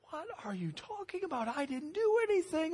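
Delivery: put-on high, protesting teenage boy; indignant kid denial. Thirteen-year-old boy protesting to mom he did nothing, indignant. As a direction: plead your innocence, bewildered.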